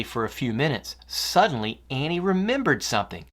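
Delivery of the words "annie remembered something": ordinary delivery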